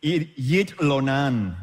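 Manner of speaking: "Yit Lonan" said slowly and deliberately; Hebrew phrase. Shown as a demonstration. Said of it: "Lonan is actually spend the night".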